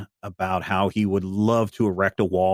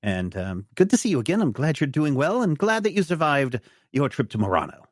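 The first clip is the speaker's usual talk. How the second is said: high, fluttery academic voice; a distracted scientist excusing himself; the doctor leaving for his lab, high and fussy; 6 semitones higher than usual. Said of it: "the doctor is like".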